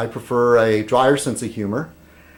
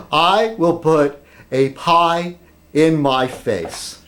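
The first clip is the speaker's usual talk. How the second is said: very slow, measured declaration; deliberate announcement; much slower than usual. Acted. Announcing the pie stunt to viewers, slow and deliberate. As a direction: space out each phrase for ceremony.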